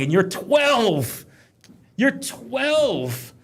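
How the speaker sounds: high, drawn-out disbelief; incredulous shaming. Retold or imitated. Dad to twelve-year-old scared of a ride, incredulous shaming. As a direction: stretch TWELVE long and high, mock appalled. Retold shaming of older child.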